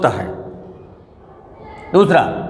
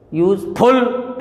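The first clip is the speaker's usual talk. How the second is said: Useful slow, with FUL drawn out heavily; stress on second syllable; 2 semitones higher, much slower than usual. Shown as a demonstration. Teacher showing how nobody says it, second syllable stressed. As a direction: wrongly lean on FUL in useful. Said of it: "ऐसे तो बोलते नहीं है".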